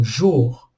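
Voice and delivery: jour said alone, firm and falling; isolated stressed syllable. Shown as a demonstration. Teacher isolating the stressed syllable, firm falling jour. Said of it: "a última sílaba é a tônica".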